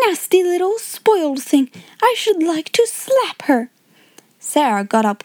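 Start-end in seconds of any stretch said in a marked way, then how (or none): 0.1-3.7 high-pitched schoolgirl spite; spiteful outburst. A narrator's reading. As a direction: complain indignantly about a spoiled brat. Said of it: "broke out Lavinia".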